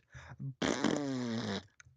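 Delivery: eerie cackle from the puppet before it explodes; a taunting laugh, thumb on its nose; puppet's parting mock laugh. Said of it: "it's Vincent's laugh".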